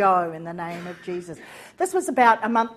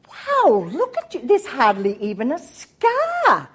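amazed; 3 semitones higher, 5 dB louder than usual. Retold or imitated. Amazed at healed face.

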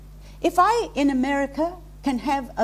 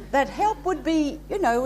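ordinary talk

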